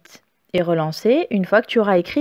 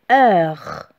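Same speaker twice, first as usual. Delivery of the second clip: syllabe isolée, posée, plus grave; dictée articulée; 5 semitones lower than usual. Shown as a demonstration. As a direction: dicter le mot seul pour l'élève. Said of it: "Attention pour le heure". (voix de dictée grave et nette)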